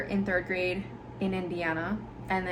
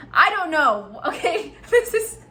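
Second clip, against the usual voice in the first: embarrassed laugh; 8 semitones higher, 8 dB louder than usual. Real, not acted.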